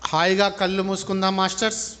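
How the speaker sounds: slow, drawn-out meditation guidance; measured phrases with long settling pauses; guided meditation. Real, not acted. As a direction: lead them into breath awareness, unhurried.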